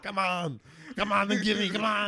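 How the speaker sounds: shouted Austrian action-hero taunt; Arnold voice. Retold or imitated. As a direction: bark it like a movie muscleman.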